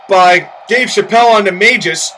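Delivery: normal talking voice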